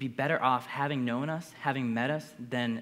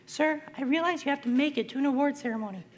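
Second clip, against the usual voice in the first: much higher imitated voice; a different voice; 12 semitones higher than usual. Escort telling him she knows about the ceremony, imitated in a much higher voice. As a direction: mimic her suddenly pitched up high. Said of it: "her voice sounded a little different".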